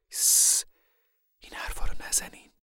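whispered, restraining plea; hushing whisper. Acted. Mother shushes the family about Connie, whispering. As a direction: hush them under your breath. Mother hushes talk near crying daughter.

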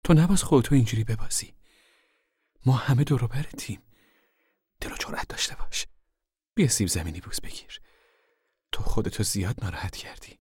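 firm, earnest motherly urging; serious. A narrator's reading. Mother tells her upset daughter to pull herself together, serious. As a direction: start high and firm, then settle. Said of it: "مادر با لحنی جدی ادامه داد".

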